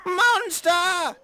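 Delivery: very high, loud terrified wail. Acted. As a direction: wail in horror as you flee. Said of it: "he wailed".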